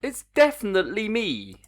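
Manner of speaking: high, loud protest at the screen; exasperated outburst. Real, not acted. Coder pleading with the bot check, loud and exasperated. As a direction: insist it is really you, fed up.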